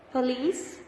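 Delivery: "police" read out with LICE stressed and rising; stress on second syllable. Shown as a demonstration. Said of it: "notice which syllable is the stressed one".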